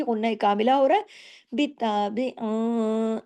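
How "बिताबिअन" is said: बिताबिअन stretched slow with a long nasal hum on the noon; nasal ghunna on बिताबिअन. Shown as a demonstration. Teacher showing students ghunna kamila, बिताबिअन hummed long. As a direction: hold the noon of बिताबिअन through the nose. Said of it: "ये जो अं करके आवाज़ है".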